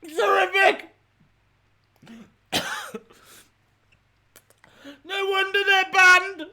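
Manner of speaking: high, strained distress; pained complaint. Real, not acted. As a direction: wail it through real suffering. Suffering from banned sour sweets.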